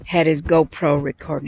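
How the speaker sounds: normal talking voice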